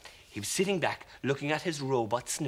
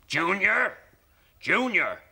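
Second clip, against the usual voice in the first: bellowing; 6 semitones higher, 6 dB louder, slower than usual. Acted. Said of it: "roughest roaring voice he'd ever heard come bellowing".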